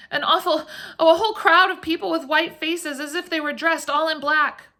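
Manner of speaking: high, breathless panting. A narrator's reading. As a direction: pant it out in fright. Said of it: "in a breathless voice".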